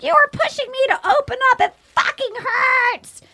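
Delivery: high-pitched retold breakup outburst; upset outburst. Girlfriend lashing out at the man dumping her, high-pitched mock upset. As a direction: imitate a wounded, exasperated girlfriend.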